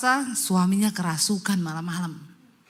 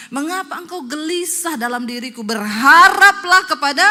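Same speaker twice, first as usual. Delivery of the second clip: forceful scripture declamation; 8 semitones higher, 11 dB louder than usual. Acted. Preacher quotes the psalm to congregation, building forceful declamation. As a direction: swell into a ringing exhortation.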